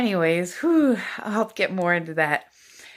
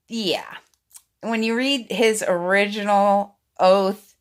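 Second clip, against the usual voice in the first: ordinary delivery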